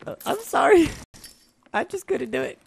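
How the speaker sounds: high whining apology; squeaky mock sorry; mock whiny apology. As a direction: whimper it like a kid. Mock apology after dying.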